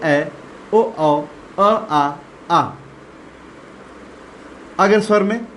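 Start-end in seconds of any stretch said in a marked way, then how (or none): 0.7-2.7 ओ, औ, अ, आ very slow with mouth wide open; मुंह खोलकर धीरे-धीरे; slow open vowels. Shown as a demonstration. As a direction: draw out each open vowel slowly. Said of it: "मुंह अधिक खुलता है".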